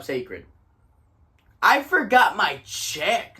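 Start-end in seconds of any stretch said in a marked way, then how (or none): 1.6-3.4 slow, drawn-out words. Shown as a demonstration. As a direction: stretch each word out slowly. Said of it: "Listen to that".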